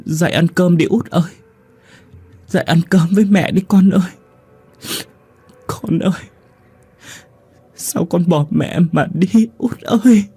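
put-on high, wailing sob to her dead child; crying mother. Grieving mother calls her dead youngest to eat, high crying wail. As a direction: wail it, begging the child to wake. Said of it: "vừa khóc vừa nói".

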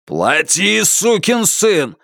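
growing roar, stretching the last words. A narrator's reading. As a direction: bellow it slowly, without full volume. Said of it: "взревел Бернхард".

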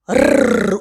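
short rolled-r growl; tiger growl. Acted. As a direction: growl briefly before speaking.

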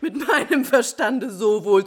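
wild laughter breaking into crying; hysterical laugh. Acted. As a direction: laugh through tears, half mad.

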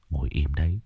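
soft, low hushed command; hushed order. A narrator's reading. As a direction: keep him still without a sound. Calming scared man, stay quiet. (ra lệnh khẽ)

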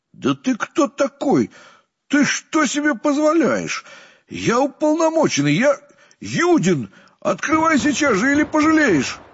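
official threatens the stubborn gatekeeper, outraged shouting; outraged yell. A narrator's reading. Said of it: "от возмущения перехватило дыхание".

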